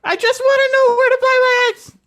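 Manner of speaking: put-on very high, loud kid wail; whining child. Pretend child insists on buying eggs, loud squeaky child voice. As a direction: protest in a piercingly high child's voice. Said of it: "That voice is the voice that I use".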